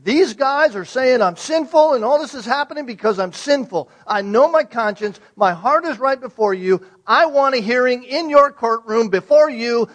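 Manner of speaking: loud, high, desperate demand for a hearing; Job's loud plea. Acted. Job demanding a hearing in God's courtroom, loud and desperate. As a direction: cry out to God demanding justice. Voicing Job pleading his case.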